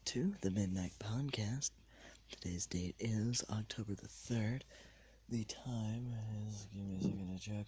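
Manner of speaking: late-night whispered podcast; whispering. Real, not acted. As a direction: keep it to a hushed whisper.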